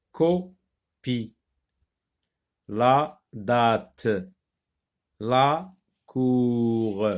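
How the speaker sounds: slow dictation, each word set apart by pauses; careful word reading. Shown as a demonstration. Teacher reading a word list for pupils, careful word reading. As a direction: read the list word by word, stretching syllables. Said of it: "on va les lire d'abord".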